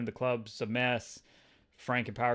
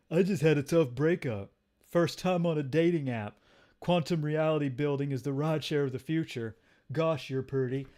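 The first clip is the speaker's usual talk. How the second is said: awkward dater in a put-on voice; slightly raised, put-on voice of an eager date; Paul's voice; 3 semitones higher, 3 dB louder than usual. Paul chatting up his date, in a put-on dorky voice. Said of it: "okay, now Paul's talking".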